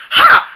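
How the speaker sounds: a sharp high mocking ha; triumphant ha. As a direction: bark a gleeful ha. Mocking laugh, code broken.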